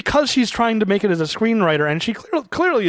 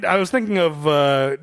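ordinary talk

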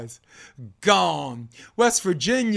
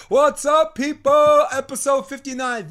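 much higher, louder hype greeting; hyped announcer; 10 semitones higher, 8 dB louder than usual. Pretend. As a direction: shout it like a hype man. Redoes the intro greeting with more hype.

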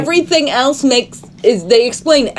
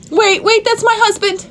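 mock Tess plea; 12 semitones higher than usual. Mock Tess line in movie recap.

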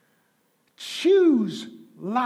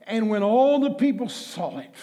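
normal talking voice

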